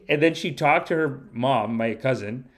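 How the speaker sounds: normal talking voice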